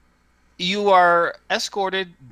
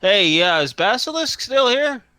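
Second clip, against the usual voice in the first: raised, higher call to someone inside; gruff call out; 8 semitones higher, 4 dB louder than usual. Acted. Doorman checks with someone behind the door, gruff call out. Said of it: "the same gruff voice call out".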